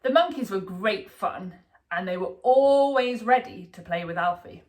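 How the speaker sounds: usual speaking voice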